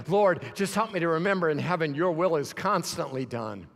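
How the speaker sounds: normal talking voice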